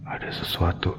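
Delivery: barely voiced, alert to a noise; hushed whisper. Real, not acted. Vlogger at a haunted hospital, hushed whisper. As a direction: whisper it, something's out there.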